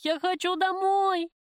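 high, loud, drawn-out wail; screaming. A narrator's reading. Prisoner's cry echoes down the corridor, desperate scream. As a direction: cry it out slowly in anguish. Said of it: "Гулким эхом разнеслось по коридору".

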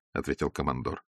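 ordinary delivery

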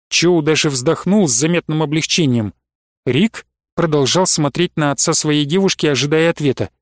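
ordinary delivery

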